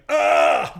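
loud startled scream; loud frightened yelp; panicked yell. Retold or imitated. Panicked yell seeing the dog.

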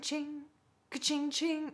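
high, sing-song ka-ching sound; light mock clinking noise; imitated gate clanking. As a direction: chime it out like a rattling gate latch. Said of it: "and I hear ka-ching".

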